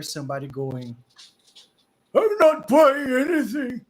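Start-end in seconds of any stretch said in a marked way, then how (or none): 2.1-3.9 put-on high, loud cranky old man behind the door; grumpy old man. Old man shouting through the door at visitors, cranky.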